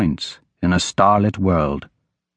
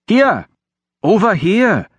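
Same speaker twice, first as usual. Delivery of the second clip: high, louder call across a crowd; 10 semitones higher, 4 dB louder, slower than usual. Acted. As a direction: call someone over, pitched way up. Boy calls across crowded pier.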